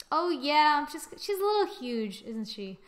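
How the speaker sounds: normal talking voice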